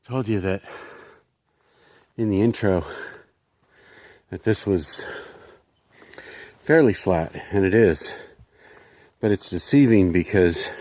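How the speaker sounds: winded from the uphill climb; breathless, pushing words out; out of breath. Real, not acted. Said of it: "I'm out of breath".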